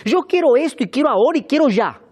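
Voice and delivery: high, loud mock tantrum; tantrum-throwing child. Pastor mimicking children who want everything now, insistent and high. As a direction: demand it like a bratty kid. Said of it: "somos como niños berrinchudos".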